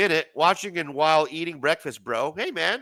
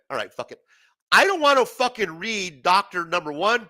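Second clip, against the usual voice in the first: ordinary talk, nothing marked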